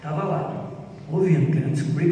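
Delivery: usual speaking voice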